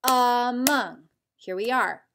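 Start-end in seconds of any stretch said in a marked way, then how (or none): 0.0-1.0 "Among" broken into two clapped beats, a-mong; clapped syllables. Shown as a demonstration. Teacher claps out the word for students, beat by beat. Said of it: "Let's clap out the syllables for among".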